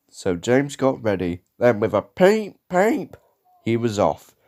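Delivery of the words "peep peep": high-pitched "peep" toots; engine whistle. A narrator's reading. James setting off, high whistle toots. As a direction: imitate a little train whistle.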